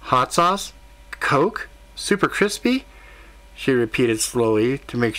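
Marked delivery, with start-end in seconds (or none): 0.0-2.8 higher, incredulous questions repeating the order; can't believe what she's hearing; shocked disbelief. Acted. Waitress checking the odd order back, shocked and much higher. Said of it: "The waitress looked shocked".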